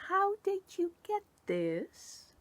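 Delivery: high, slow, careful innocent asking; high, slow, overly innocent question; feigned innocence. A narrator's reading. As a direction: tiptoe round it, high and slow. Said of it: "failing to look as innocent".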